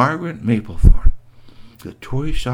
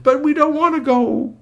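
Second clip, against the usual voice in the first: put-on whining kids. Kids protest leaving.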